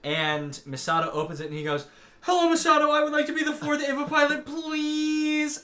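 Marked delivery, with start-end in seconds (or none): 2.2-5.6 high, loud, hammed-up eager plea; eager begging kid. Boy at the door begging to be a pilot, eager mock plea. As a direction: plead in one rushed high-pitched stream.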